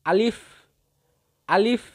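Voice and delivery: letter-drill আলিফ with a rising tune; demonstrated letter sound. Shown as a demonstration. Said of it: "মুখের খালি জায়গা হইতে".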